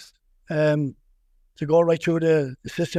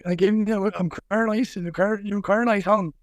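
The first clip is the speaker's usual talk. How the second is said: broad Irish Wexford accent, a little higher; 3 semitones higher, slower than usual. Shown as a demonstration. Coach hams up his Wexford accent for the host, with a broad Wexford accent. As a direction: lay on the Wexford accent, playful and teasing. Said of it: "that's a real Wexford man".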